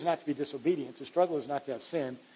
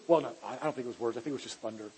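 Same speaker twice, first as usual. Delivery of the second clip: lower, quick, brushing it off; dismissive Pharisee; 5 semitones lower, 5 dB quieter, faster than usual. Retold or imitated. Preacher voicing a doubting Pharisee dismissing the voice, low and quick. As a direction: mutter the excuse fast and low, waving it away.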